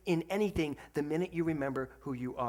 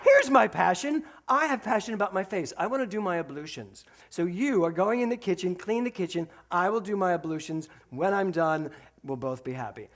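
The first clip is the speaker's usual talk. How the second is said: louder and higher, mock grand; loud mock retort; 4 semitones higher, 7 dB louder than usual. Dad to daughter, announcing his ablutions loudly. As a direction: belt it as a playful comeback. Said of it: "And then I went".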